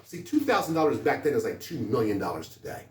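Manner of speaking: ordinary talk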